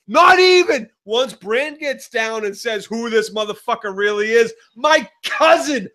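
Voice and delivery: hammed-up outrage with shouted punches; Sansa's furious rant. Acting out Sansa passed over for her half brother, mock fury. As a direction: spit out each grievance, pitch jumping high. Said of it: "It turned from like".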